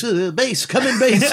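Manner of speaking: high radio call voice; crackly military radio. Retold or imitated. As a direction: call base over the radio. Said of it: "World War Two wind-up cranks".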